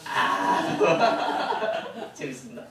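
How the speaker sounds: real laugh at a student's joke; a genuine laugh breaking into the words. Real, not acted. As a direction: burst out laughing, then amused.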